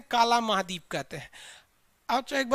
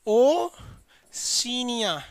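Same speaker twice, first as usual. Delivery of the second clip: drawn-out; much slower than usual. Shown as a demonstration. Teacher drawing out Oceania for students to repeat. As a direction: stretch the word Oceania very long and slow. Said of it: "कौन सा है?".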